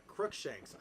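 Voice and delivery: plain reading voice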